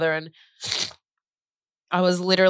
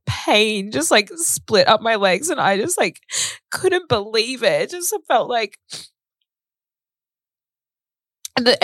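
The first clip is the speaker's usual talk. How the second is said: higher, tearful voice; real distress; 5 semitones higher than usual. Real, not acted. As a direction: fight back tears while telling it. Recalls learning of father's death.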